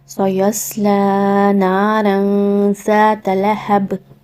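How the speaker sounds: slow, drawn-out melodic tajweed recitation; Quran recitation. Shown as a demonstration. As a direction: chant each word long and measured like a qari.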